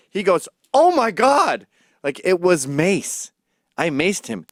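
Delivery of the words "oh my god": mock high, loud "oh my God"; shocked cry. Friend just maced, crying out in shock. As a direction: play the friend's stunned yelp, much higher and louder.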